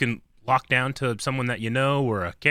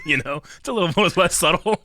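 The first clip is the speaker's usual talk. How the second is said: ordinary talk, nothing marked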